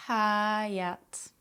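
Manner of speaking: "hayat" slow with a light breath on the H; exhaled H. Shown as a demonstration. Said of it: "Practice lightly exhaling on the H sounds".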